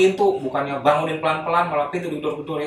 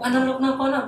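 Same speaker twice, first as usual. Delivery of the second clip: alarmed; 12 semitones higher than usual. Retold or imitated. Friends alarmed at screaming classmate.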